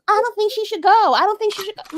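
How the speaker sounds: high, whiny mock voice; sarcastic imitation of the doubters; mocking critics. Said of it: "people were still".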